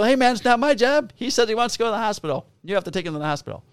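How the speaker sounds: retold insistent pushback. Officer telling the ambulance worker to take him, insistent. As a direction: argue back in a rush.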